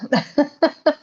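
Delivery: high quick giggle; short laugh. Real, not acted. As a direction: giggle lightly. Laughs after rebel remark.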